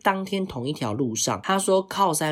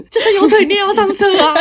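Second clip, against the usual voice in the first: loud and much higher, laughing through it; laughing mock call; 11 dB louder than usual. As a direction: call out over the motorbike while giggling. Retold friend calling from motorbike.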